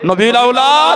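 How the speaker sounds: high, loud, stretched-out call; impassioned cry. Acted. Preacher ending Husain's reproach to his betrayers, impassioned cry. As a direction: cry it out at the climax. Preacher cries out at speech's climax.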